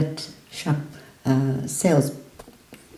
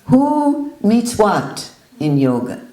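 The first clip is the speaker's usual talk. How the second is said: imitating the guru grilling a visitor; loud grilling; 4 semitones higher, 4 dB louder than usual. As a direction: press him hard on every word. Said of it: "And he said".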